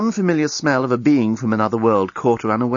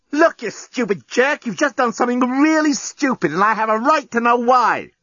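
angry; 12 semitones higher than usual. A narrator's reading. Lucy shakes him.

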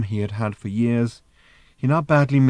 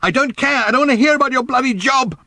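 high, loud, tearing outburst; furious shout; 8 dB louder than usual. A narrator's reading. Random rejecting her mother's excuses, shouting furiously. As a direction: scream over her explanation. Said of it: "shouted Random".

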